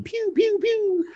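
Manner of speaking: playful high "pew, pew, pew" toy-gun noises; mock gun sounds. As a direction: make little pretend gunshots with your mouth. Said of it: "I just wanna go shoot my little gun".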